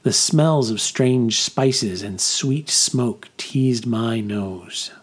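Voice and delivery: ordinary delivery, nothing marked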